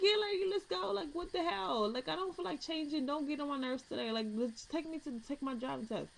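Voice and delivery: annoyed, dismissive talk-back; sassy retort. Retold or imitated. Snapping back at her mother.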